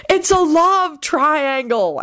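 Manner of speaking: high, slow drawn-out shout; teasing high-pitched chorus cry; group shout. A narrator's reading. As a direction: call it out slowly in a high voice. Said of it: "everyone except Ron and George shouted".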